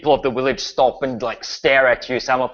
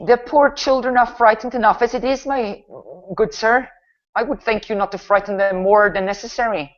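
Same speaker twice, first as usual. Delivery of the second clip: much higher, reproving woman's voice; stern older woman; 9 semitones higher than usual. Acted. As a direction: play an older villager politely scolding a stranger. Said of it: "like an older woman, gives you a, a pretty stern look".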